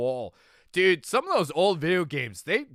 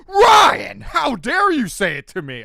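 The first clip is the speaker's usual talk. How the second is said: loud, high outraged cry; retold indignant shout; 9 semitones higher, 13 dB louder, slower than usual. Imitating his friend yelling at Ryan, loud and offended. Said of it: "She goes".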